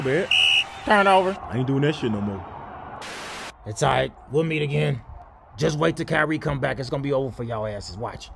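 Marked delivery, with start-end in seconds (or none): none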